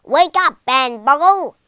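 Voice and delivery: loud, high teacher's yell; furious shout at a dozing schoolboy; angry yell. Acted. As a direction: yell at a sleeping pupil. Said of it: "yelled his teacher".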